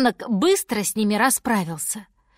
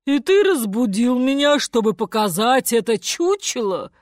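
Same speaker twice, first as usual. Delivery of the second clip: loud, indignant elephant voice; angry elephant; 2 semitones higher, 5 dB louder than usual. A narrator's reading. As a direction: scold the one who woke you. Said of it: "В голосе слонихи был гнев".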